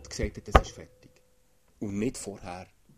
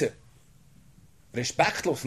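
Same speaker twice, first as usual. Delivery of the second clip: normal talking voice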